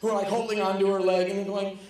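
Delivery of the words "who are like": ordinary talk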